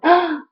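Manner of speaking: sudden high, loud "Ah!"; startled cry. Retold or imitated. Telling viewers how she got up and went "Ah!", startled cry. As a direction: re-enact a sharp yelp of shock.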